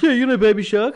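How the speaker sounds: high, loud, keen asker; mock someone eagerly asking; imitated eager question. Acting out an excited asker.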